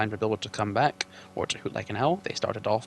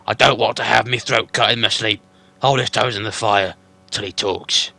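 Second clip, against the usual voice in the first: troll Bert; 10 dB louder than usual. Acted.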